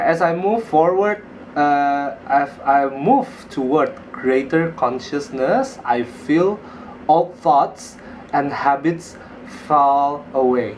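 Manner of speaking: reading slowly and deliberately; slow affirmation reading. Shown as a demonstration. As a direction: read the affirmation aloud, unhurried. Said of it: "coba saya bacain dulu ya".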